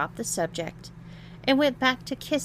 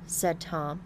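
ordinary delivery

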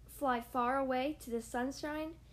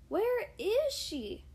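very slow, higher worried wondering; 8 semitones higher, 3 dB louder, much slower than usual. Acted. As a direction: wonder aloud, uneasy. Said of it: "Charlie wondered".

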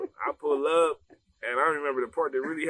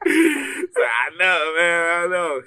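laughing through the words, loud and high; laughing reply; 4 semitones higher, 10 dB louder, much slower than usual. Retold or imitated. Student answering his teacher's praise, laughing hard. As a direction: giggle out a proud confession.

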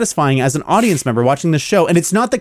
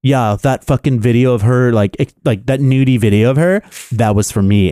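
lower, cocky mock boast; mocking Jamie claiming Keeley, lower and smug; mock Jamie Tart; 6 semitones lower than usual. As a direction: sneer it like a smug footballer, deeper. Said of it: "by being like".

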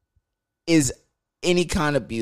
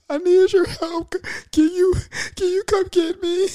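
put-on girl's pleading voice. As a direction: beg for help in a much higher voice. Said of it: "This bitch said".

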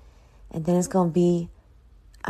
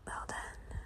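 whispered aside; 20 dB quieter than usual. Real, not acted. Searching lyrics.

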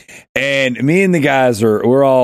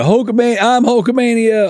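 higher-pitched imitation of a wrestling fan; imitating Bobby; 10 semitones higher than usual. Hulk Hogan fan hulking up, excited imitation. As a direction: playfully mimic a hyped fan's boast. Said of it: "he would just be, you know".

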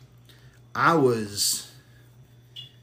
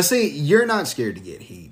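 ordinary talk, nothing marked

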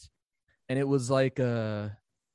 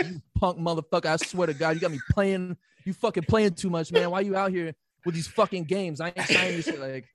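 imitated street voice; 4 semitones higher than usual.

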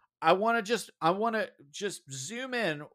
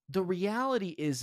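ordinary talk, nothing marked

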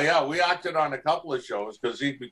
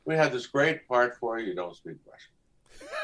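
ordinary talk

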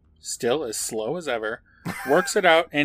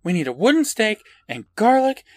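put-on urgent kid; 8 semitones higher, 5 dB louder than usual. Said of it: "Kara says".

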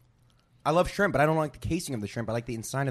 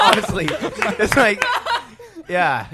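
laughing; 6 semitones higher, 6 dB louder, slower than usual. Real, not acted. Laughing hard.

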